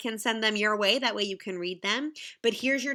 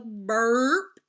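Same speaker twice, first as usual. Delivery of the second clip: burp sound; 7 dB louder than usual. Pretend. Reading noise words aloud.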